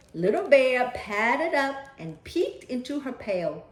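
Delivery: lively read-aloud storytelling; story narration. A narrator's reading. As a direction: read it like a bedtime picture book. Reading picture book aloud.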